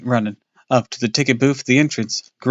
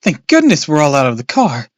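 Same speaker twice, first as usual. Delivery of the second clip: louder, higher relieved outburst; relieved cry; 5 semitones higher, 7 dB louder than usual. Acted. Mom hugging her kids after the car explodes. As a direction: let the relief burst out. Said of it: "Mom cried".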